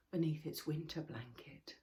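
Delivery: ordinary delivery, nothing marked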